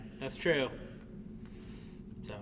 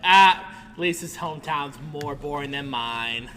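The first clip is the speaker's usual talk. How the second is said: louder, slow, gloating; gloating tease; 10 dB louder than usual. Retold or imitated. Teasing Lisa about Peru, slow and louder. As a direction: savour the jab slowly. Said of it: "I was like".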